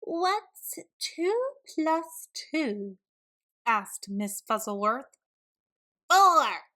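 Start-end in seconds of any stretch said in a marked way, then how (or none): 0.0-3.0 high, very slow teacher voice. Acted. Miss Fuzzleworth quizzing the class, slow high teacher voice. 6.1-6.6 loud high blurt; shouted answer. Acted. Camilla blurting the answer, loud high shout. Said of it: "shouted Camilla Capybara".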